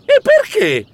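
high squeak of alarm. Acted. Mouse learning of the 7 a.m. deadline, high alarmed squeak. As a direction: yelp the question. Shocked question.

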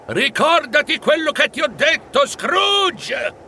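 high-pitched parting call; crying out. A narrator's reading. Ghost swept into the night warning Scrooge, crying out. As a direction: call out urgently as if being dragged away. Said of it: "fece in tempo a gridare".

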